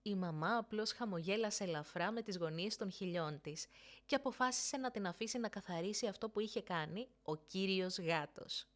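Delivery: usual speaking voice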